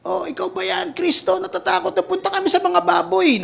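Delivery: high, whiny mock demon; a lightly voiced cowering demon; frightened demon voice. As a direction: squeak like scared demons. Demons speaking to Christ.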